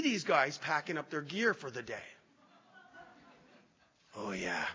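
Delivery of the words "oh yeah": low, soft, ominous; a quiet threatening growl of recognition; menacing recognition. Retold or imitated. Speaker spotting the worker he plans to confront, low and menacing.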